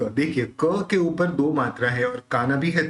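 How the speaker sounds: ordinary talk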